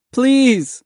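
high, desperate plea; crying out. A narrator's reading. Boy clinging to the dinghy, desperate cry. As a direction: cry it out, pitch soaring. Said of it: "Mahmud cried".

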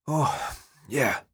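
winded, words spaced by breaths; worn-out, puffing acknowledgement; huffing. A narrator's reading. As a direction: huff it out, still catching your breath. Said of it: "he huffed".

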